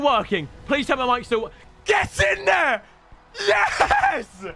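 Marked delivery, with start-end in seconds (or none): 1.9-4.4 wild celebration. Real, not acted. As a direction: yell it out in disbelief. Celebrating pack.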